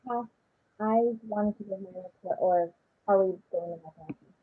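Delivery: muffled voice, bad mic. Real, not acted. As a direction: voice muffled as if through a wall. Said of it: "is my voice muffled?".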